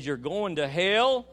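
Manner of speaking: loud, slow, climbing into HELL; bold retold pronouncement. Preacher retelling a face-to-face message to the governor, bold pronouncement. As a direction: raise pitch and volume towards a drawn-out HELL.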